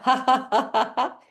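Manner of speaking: high, rhythmic ha-has; blender laugh. Shown as a demonstration. Shaking up the drink like a blender, rhythmic laugh. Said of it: "like a blender".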